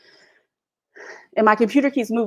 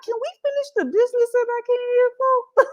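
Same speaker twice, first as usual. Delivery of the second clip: shocked retort; 13 semitones higher than usual. Retold or imitated. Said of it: "I'm like".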